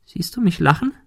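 hohe, höhnische Stimme eines Bockwesens; spöttische Kreaturenstimme. A narrator's reading. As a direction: neckend und überlegen, viel höher. Monika neckt weiter. (Monika als Bock verspottet den Detektiv, hoch und höhnisch)

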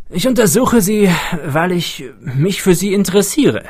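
animated, excitable researcher; eccentric older scientist, keen. A narrator's reading. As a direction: explain your pet research with enthusiasm.

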